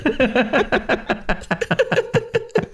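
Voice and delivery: long high giggle. Real, not acted. Laughing at himself.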